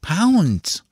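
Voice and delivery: put-on high-pitched child's correction; small boy taken aback, pitched high; one high, surprised word. A narrator's reading. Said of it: "with a surprised look".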